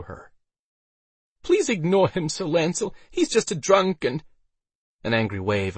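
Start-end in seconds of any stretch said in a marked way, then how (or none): 1.4-4.2 high-pitched anxious appeal; Elsa's higher voice, appeasing; pleading woman. A narrator's reading. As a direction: plead to smooth things over, pitched high. Elsa pleads to silence drunk.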